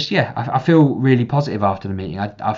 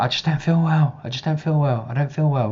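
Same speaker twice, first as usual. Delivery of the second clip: put-on higher, droopy child's voice repeating it; whiny little boy; 7 semitones higher than usual. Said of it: "And he'd be like".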